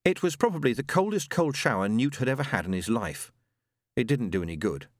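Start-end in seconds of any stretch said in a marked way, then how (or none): none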